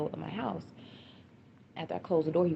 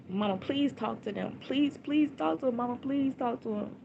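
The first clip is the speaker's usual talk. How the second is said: retold urgent plea, higher voice; pleading; 7 semitones higher than usual. Daughter begging mama to talk to the man on the phone, pleading. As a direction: beg over and over, pitched up.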